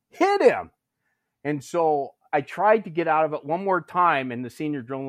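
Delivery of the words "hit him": very high, insistent command; escalated, high-pitched bark; retold yelled order. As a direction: imitate a sergeant at the end of patience.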